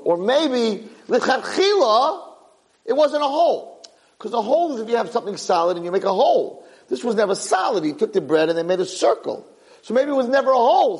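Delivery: high, sing-song Talmudic musing; musing guard. Acted. The guard unfolding his bagel theory to his boss, high sing-song. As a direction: chant the idea like a yeshiva student.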